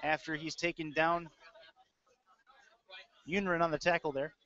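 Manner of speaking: ordinary delivery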